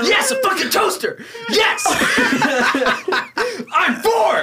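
mock overjoyed kid. Mocking an overexcited kid.

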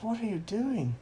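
high-pitched baffled question; Captain America. Acted. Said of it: "Captain America's like".

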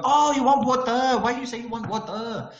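shrill sing-song Malaysian scolding; imitating a Malaysian accent. Waiter scolding the tourist, in high Malaysian accent. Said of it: "And they go".

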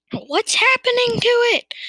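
high-pitched, loud startled question; alarmed cry. A narrator's reading. Farmer watching his chicken's legs grow, crying out in alarm. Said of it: "cried Mister Killiecranky".

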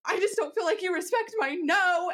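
high, hammed-up wounded protest; mock dramatic. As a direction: play the drama queen, half laughing. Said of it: "trying to be real dramatic".

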